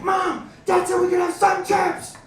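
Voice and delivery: put-on high, loud, slow kid yell; kid screaming. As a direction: yell it at mom like an overexcited kid. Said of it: "he starts screaming at his mom".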